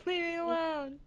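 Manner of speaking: high, soft kiddie pout; little kid. Acted. Defending her excitement over games, playing little kid. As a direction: plead like a sulky little kid. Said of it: "I'm, I'm a little kid".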